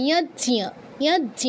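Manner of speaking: ইয়াজ্ঞ said yaj-nya, both consonants sounded; j-ny conjunct. Shown as a demonstration. Teacher models for students, ইয়াজ্ঞ as yaj-nya. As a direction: split জ্ঞ in ইয়াজ্ঞ into j plus ny. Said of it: "এটার উচ্চারণ কি হবে".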